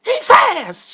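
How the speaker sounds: high, loud excited yell; excited shout. Retold or imitated. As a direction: burst in shouting the news. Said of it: "went to the crib like".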